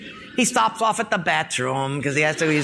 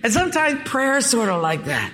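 laughing; 4 semitones higher than usual. Real, not acted. Still laughing after joke.

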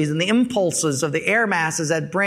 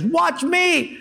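cocky boast; 9 semitones higher than usual. Retold or imitated. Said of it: "The Japanese guy says".

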